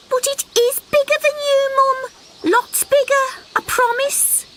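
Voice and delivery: high wheedling little frog; pleading little frog. Acted. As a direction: beg your mum sweetly. Frog insisting.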